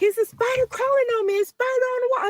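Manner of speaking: put-on high, fast voice of a frightened child; scared little boy. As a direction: imitate your scared little son, pitched high. Mother retells son's night terror.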